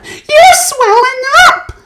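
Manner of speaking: high, loud slow scream. A narrator's reading. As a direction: shriek in horror. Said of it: "screamed Missus Beauregard".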